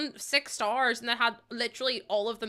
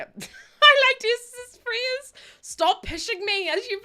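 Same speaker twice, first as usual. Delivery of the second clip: laughing; 14 semitones higher, 8 dB louder, slower than usual. Real, not acted. Laughing at friend's phrase.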